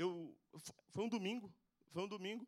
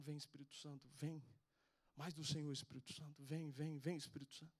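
low, soft repeated invocation; retold prayer; 5 semitones lower, 6 dB quieter than usual. Man praying over a screaming boy in the street, soft low prayer. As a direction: re-enact praying softly over someone. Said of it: "e orando, na avenida cheia".

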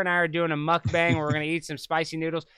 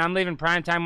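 normal talking voice

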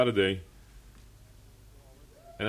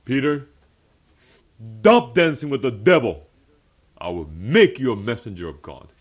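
loud, emphatic voice of the Lord; commanding, punched-out summons; the Lord calling; 8 dB louder than usual. Retold or imitated. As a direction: call out a firm divine command. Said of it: "the Lord is kinda calling me saying".

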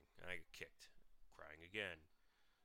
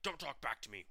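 put-on loud, higher snapping at a kid; retold angry yell; 4 semitones higher, 9 dB louder than usual. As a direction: bark the order like an abusive stepdad. Retold stepdad yelling before slap.

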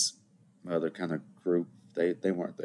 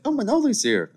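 high, loud mock-surprised exclamation; surprised greeting; 7 dB louder than usual. Dad and little brother spotting him at dinner, surprised. Family greeting his arrival, acted.

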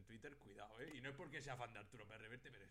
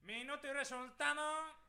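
mock announcer; 13 semitones higher, 11 dB louder, slower than usual. Mocking a sports account phrase.

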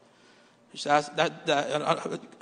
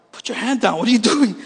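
raised, urgent mock scolding; scolding wife; 4 semitones higher, 3 dB louder than usual. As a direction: scold him like an alarmed wife. Wife scolding him, retold.

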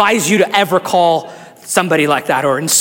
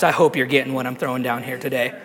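ordinary talk, nothing marked

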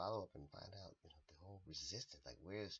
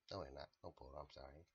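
usual speaking voice

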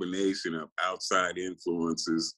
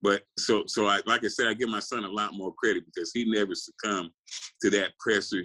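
usual speaking voice

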